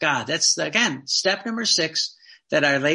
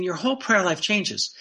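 ordinary talk, nothing marked

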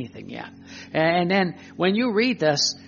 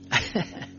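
short breath of a laugh; brief low-key chuckle; small laugh before carrying on; real chuckle; 4 dB quieter than usual. Real, not acted. Laughs before remark on God.

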